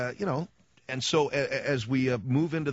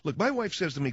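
usual speaking voice

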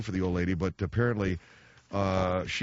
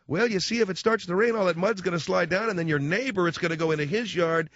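put-on high-pitched, explaining Minnesota accent; 8 semitones higher than usual. Father-in-law explaining where the mud will slide, high Minnesota accent. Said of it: "Minnesota accent".

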